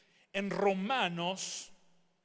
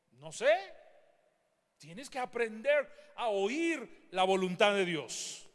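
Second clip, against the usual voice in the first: usual speaking voice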